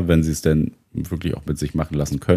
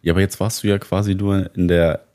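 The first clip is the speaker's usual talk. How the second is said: ordinary talk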